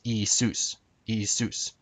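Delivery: Isus said ee-SOOS; modern Greek pronunciation. Shown as a demonstration. Teacher shows modern Greek, Isus said ee-SOOS. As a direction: say Isus in two syllables, stressing the soos. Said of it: "Jesus would be pronounced".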